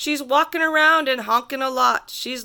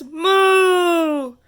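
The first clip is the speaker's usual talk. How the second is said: long, higher, louder moo; cow moo; 5 semitones higher, 5 dB louder than usual. Acted. The cow looks at the kid and moos, loud drawn-out moo. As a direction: moo out like a big cow in the road. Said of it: "and the cow says".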